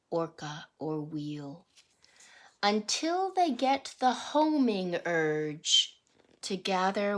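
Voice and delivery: rhythmic picture-book read-aloud; reading to children. Acted. Teacher reading a salmon picture book to the class, sing-song read-aloud. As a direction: read the verse to the class with a lilt.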